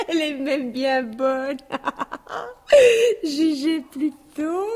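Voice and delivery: slow, tearful laughter through the words; laughing to tears. Acted. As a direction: laugh so hard it almost cries. Laughing till near tears.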